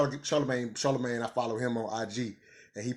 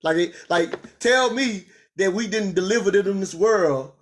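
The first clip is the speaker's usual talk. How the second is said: heated retort; 5 semitones higher, 4 dB louder than usual. Real, not acted. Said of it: "I say".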